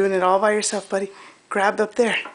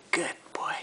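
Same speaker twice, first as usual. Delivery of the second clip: whispering; 12 dB quieter than usual. Real, not acted. Parent encouraging baby quietly.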